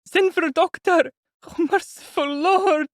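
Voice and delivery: high, loud, slowing into prayer; frightened daughter, high and loud; panicked plea. A narrator's reading. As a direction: cry for help then call on God. Sonia in panic.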